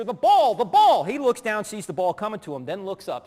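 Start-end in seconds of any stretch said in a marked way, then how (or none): 0.0-1.0 loud, higher sideline warning; retold urgent shout. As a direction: urge a child from across the field. Dad urgently calling to son.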